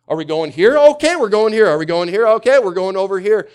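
excited heart jumping here and there, high and loud; frantic back and forth. Acted. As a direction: play a restless heart that swings from place to place. Acting out a wandering heart.

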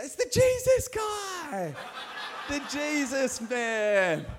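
high, loud, slow squealing call with a laugh; giggling girls calling out to the scripture teacher; excited girls. Retold or imitated. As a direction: shriek it like thrilled schoolgirls spotting him.

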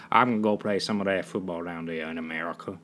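low, mock Southern accent. Shown as a demonstration. Podcaster showing he can do Southern, hammed-up low Southern accent. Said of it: "I can do Southern".

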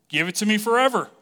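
the woman at the well asking for water, eager and quick; eager plea. Retold or imitated. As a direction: blurt it out eagerly.

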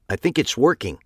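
a mild chipmunk voice from the helium; like Alvin. Acted. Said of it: "sounding very much like Alvin".

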